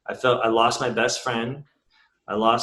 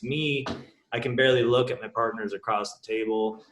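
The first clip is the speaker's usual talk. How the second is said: usual speaking voice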